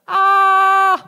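high, sustained "Aah" yell; loud scream. Acted. Woman obeying the voice in the mall, loud acted scream. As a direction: scream it out at full volume, held long. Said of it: "und schreit laut".

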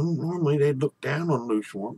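ordinary delivery, nothing marked

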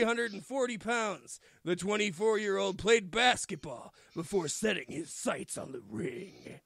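slow-named wrestler bio read like an announcer; mock-serious prospect announcement. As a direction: introduce the trainee like a promo read. Reading wrestler bio aloud.

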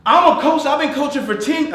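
loud, high-pitched coach. Real, not acted. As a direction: belt it out, excited. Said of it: "I was gonna get loud".

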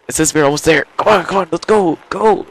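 high, mounting excitement; excited urging. Real, not acted. Player almost at the finish, excited urging. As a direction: push it on, pitch climbing.

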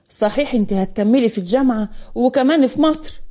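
ordinary delivery

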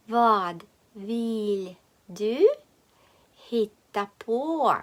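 "Vad vill du hitta på" slowly, d sounded in "Vad"; slow word-by-word. Shown as a demonstration. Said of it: "Повторюємо вголос". («Vad vill du hitta på» повільно, по словах)

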